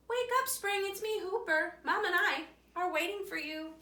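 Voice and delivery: high, loud wake-up call. A narrator's reading. Rabbit calling into the cave to wake the bear, loud and high. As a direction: rouse the sleeper, loud and high.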